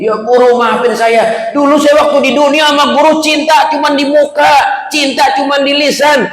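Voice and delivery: high, impassioned begging for forgiveness; pleading confession. Acted. Pupil begging teacher's pardon, high desperate plea.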